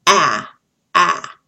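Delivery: "ah, ah" as an isolated short A, punched out; short A sound. Shown as a demonstration. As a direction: say the short A, "ah", twice, loudly. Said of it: "short A".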